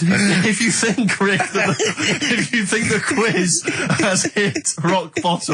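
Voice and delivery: high, breaking up with real laughter; can barely get the words out for laughing; giggling through the line, pitched high. Real, not acted. Cracking up reading the prizes.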